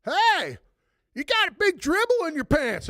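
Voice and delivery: mock girlfriend call-out. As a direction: point it out loud in front of everybody. Girlfriend calling out the dribble.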